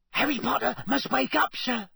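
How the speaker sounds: squeaky, high-pitched house-elf urging; Dobby. A narrator's reading. Elf waking Harry in the library, squeaking urgently. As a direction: squeak it urgently, far above normal pitch. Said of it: "squeaked Dobby".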